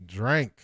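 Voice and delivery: "Drank" with the short a of "drank", Atlanta style. Shown as a demonstration. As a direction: say "Drank" like they do in Atlanta's Dirty South, not "drink". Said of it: "pronounce it as drank".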